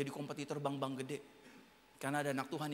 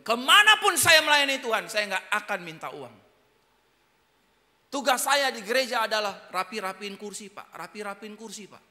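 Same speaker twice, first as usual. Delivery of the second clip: earnest officer; 5 semitones higher, 11 dB louder than usual. Retold or imitated. Voices the humble officer.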